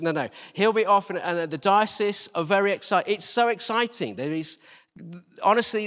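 normal talking voice

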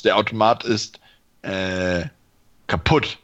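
ordinary talk, nothing marked

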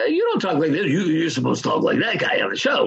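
high, whiny put-on voice of a puzzled stranger; puzzled and a little accusing; mocking stranger. Stranger insisting he should sound like his TV character, high whiny mock voice. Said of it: "would say".

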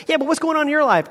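high, fast insistent question; pressing friend. Acted. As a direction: press the dodger, pitch lifted. Acted friend presses again.

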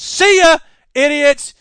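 high, loud sneering yell; mocking shout. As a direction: yell it at them, dismissive. Voicing Apple dismissing Foxconn.

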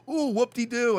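high mock delight; sarcastic. Caller mocking the agent's small credit offer, high and sarcastic.

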